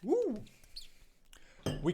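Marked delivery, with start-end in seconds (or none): 0.0-0.4 high whooping cheer; excited cheer. Real, not acted. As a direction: whoop with delight. Cheer after a success.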